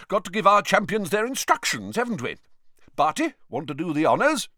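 high-pitched, eager chivvying; excited. A narrator's reading. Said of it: "looked rather excited".

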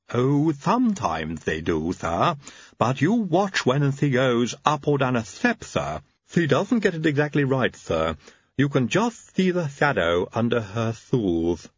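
slow lisping servant voice; Igor lisp. Acted. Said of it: "The lisp could be a problem".

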